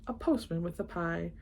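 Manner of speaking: plain reading voice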